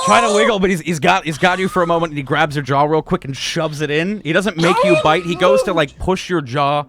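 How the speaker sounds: loud, high, urgent telling; excited narration. A narrator's reading. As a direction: narrate the struggle at full volume.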